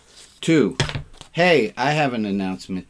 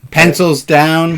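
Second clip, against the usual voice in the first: exam proctor; 7 semitones higher, 11 dB louder, slower than usual. Pretend. Calling time like an exam proctor.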